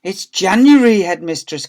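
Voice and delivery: loud, exasperated correction; correcting. A narrator's reading. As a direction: stress JANUARY high and loud. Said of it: "corrected the secretary".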